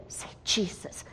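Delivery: "Say Jesus." whispered, breathless and urgent; whispered plea. Retold or imitated. Mother pleading with the lifeless boy, whispered. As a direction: whisper it close to his ear. Urging dying boy to speak.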